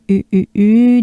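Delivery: U, pursed lips, droopy mouth; exaggerated U; French U sound. Shown as a demonstration. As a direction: round the lips for U. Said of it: "here we have the U again".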